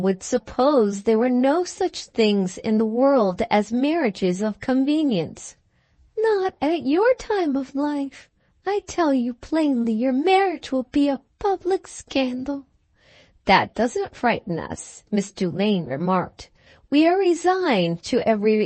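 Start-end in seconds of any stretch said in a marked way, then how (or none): 6.2-12.7 high-pitched, scandalized lady friend; disapproving friend. A narrator's reading. Friend objecting to the marriage as a scandal, high and disapproving.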